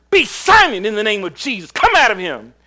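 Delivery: loud, high command in Jesus' name; commanding rebuke. Retold or imitated. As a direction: cast the spirit out with full force. Preacher commands the spirit out.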